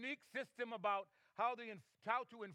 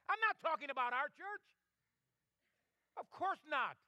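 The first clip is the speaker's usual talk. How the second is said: high, challenging pulpit questions; fervent preaching; 5 semitones higher than usual. Real, not acted. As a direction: press the congregation with each question. Challenges the congregation while preaching.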